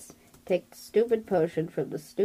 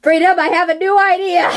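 loud, laughing, high exclamation; excited shout; 10 semitones higher, 13 dB louder than usual. Real, not acted. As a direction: burst out with the idea.

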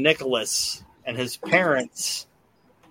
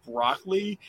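put-on high, whiny little boy voice, rising at each end; bratty kid; 5 semitones higher than usual. Bald boy at the table whining to his parents, higher kid voice. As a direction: play the spoiled kid complaining at dinner. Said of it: "that little kid was like".

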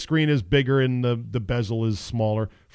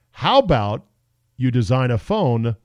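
normal talking voice

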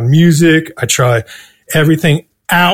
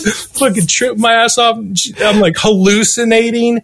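ordinary talk

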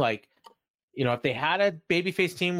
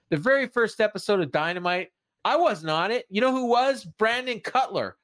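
much higher-pitched mock promo complaint; aggrieved promo; 9 semitones higher than usual. Wrestler complaining he missed the first episode, imitated aggrieved promo. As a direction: build to a pointed, imitated grievance.

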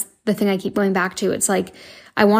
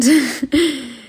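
embarrassed laugh; 5 semitones higher than usual. Real, not acted. Laughs off emotional moment.

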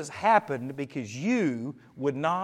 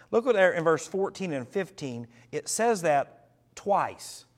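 normal talking voice

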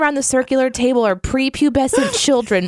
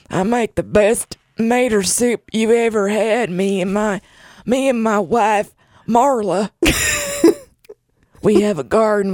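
put-on old man voice. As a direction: play a chatty old gardener. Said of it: "Have you ever heard me do my old man voice?".

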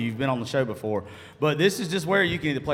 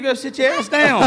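laughing shout; 12 semitones higher, 8 dB louder than usual. Real, not acted.

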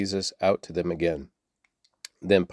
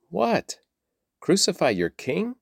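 incredulous question; 10 semitones higher than usual. A narrator's reading. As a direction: ask it in astonished disbelief, pitch leaping up. Pilate questions the shouting crowd.

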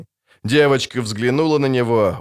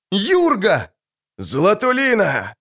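joyful calling; 8 semitones higher, slower than usual. A narrator's reading. Husband and wife call out.

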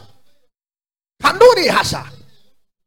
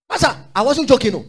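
ordinary talk, nothing marked

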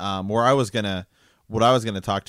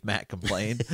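usual speaking voice

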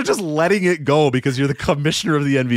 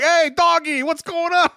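much higher, excited fan calling in; eager caller voice; slower than usual. Retold or imitated. Fan calling Mad Dog's show, high excited caller voice. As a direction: gush like a caller finally on air. Caller greeting radio host.

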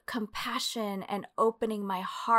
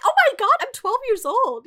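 much higher, louder incredulous cry; exasperated disbelief; 14 semitones higher, 6 dB louder than usual. Retold or imitated. Twelve-year-old girl protesting to church, high exasperated. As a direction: throw up your hands in disbelief.

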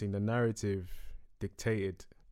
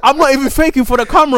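loud insisting; 9 semitones higher, 12 dB louder than usual. Real, not acted.

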